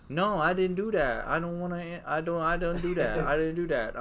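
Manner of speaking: a hint of a small boy swearing he's innocent; imitating son's denial. Toddler son denying hitting TV.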